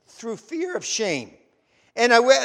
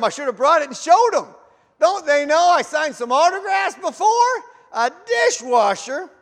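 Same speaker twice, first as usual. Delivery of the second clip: wounded pride, rising louder and higher; indignant disbelief; 4 semitones higher, 5 dB louder than usual. Retold or imitated. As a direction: scoff at the insult of being offered dishwashing. Indignant inner rant about dishwashing.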